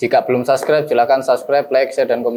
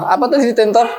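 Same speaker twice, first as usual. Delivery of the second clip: laughing, higher question; 10 semitones higher than usual. Real, not acted. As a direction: chuckle as you ask the word again. Laughs at new word. (bertanya sambil tertawa)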